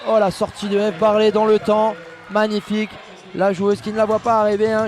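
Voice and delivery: ordinary talk, nothing marked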